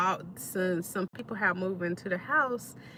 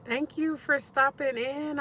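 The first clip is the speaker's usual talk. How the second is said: usual speaking voice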